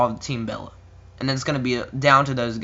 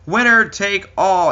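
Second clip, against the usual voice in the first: higher, slow, booming announcer call; 6 semitones higher, 5 dB louder, slower than usual. Pretend. Ring announcer hyping the title versus title match.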